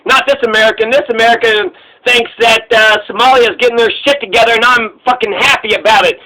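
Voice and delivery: normal talking voice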